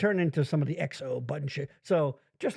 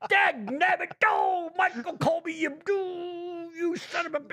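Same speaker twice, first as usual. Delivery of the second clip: Bill Cosby impression; 11 semitones higher than usual. Mocking friend in a high Cosby voice. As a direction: yell it up high like a sputtering Cosby. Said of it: "that was getting dangerously close to Cosby".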